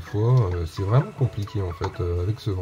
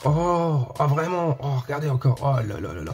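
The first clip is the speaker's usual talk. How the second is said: thrilled; 8 semitones higher than usual. Real, not acted. Showing the gold Claptrap to viewers, high, gushing delight. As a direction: coo over the gold Claptrap with wonder. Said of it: "j'en tremble, j'en tremble".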